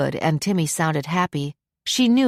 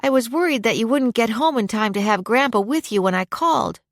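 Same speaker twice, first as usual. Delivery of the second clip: put-on higher-pitched child's voice; lightly voiced kid; happy young boy; 6 semitones higher than usual. A narrator's reading. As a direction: play an eager young boy. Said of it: "a happy young voice".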